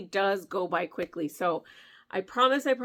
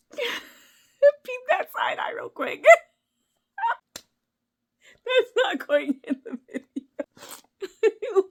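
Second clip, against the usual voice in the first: laughing; 13 semitones higher, 5 dB louder, much slower than usual. Real, not acted. Laughing at herself on camera.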